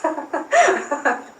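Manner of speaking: a burst of high, loud laughter; real laugh. Real, not acted. To the listener after reading the text, loud laughing.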